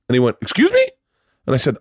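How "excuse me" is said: very high, rising affronted question; squeaked-up offended query; indignant disbelief. Retold or imitated. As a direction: mimic a boss who can't believe the refusal. Caller reacts to being refused.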